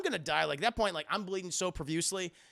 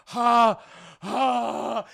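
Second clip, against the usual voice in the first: loud, higher, drawn-out gasps; mock gasping of a wounded man; acted loud gasps; 5 semitones higher, 10 dB louder than usual. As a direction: gasp out loud, twice, with a pause. Acting out stabbed man's breaths.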